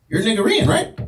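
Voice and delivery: high, eager voice of a stranger guessing; lightly voiced eager stranger; curious stranger. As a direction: play the nosy guy who thinks he knows you. Stranger quizzing him.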